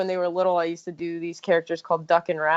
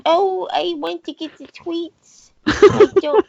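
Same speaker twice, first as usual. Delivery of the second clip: high cartoon pet complaining, rising to a louder burst; Chrono's voice; 9 semitones higher, 6 dB louder than usual. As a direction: whine like a spoiled cat who wants the tweets now. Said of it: "and Chrono goes".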